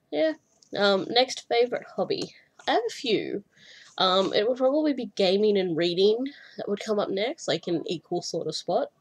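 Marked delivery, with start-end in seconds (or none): none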